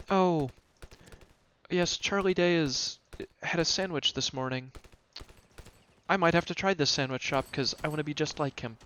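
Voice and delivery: a light sarcastic mock of a gushing fan; lightly voiced gushing fan. Mocking a celebrity-obsessed fan.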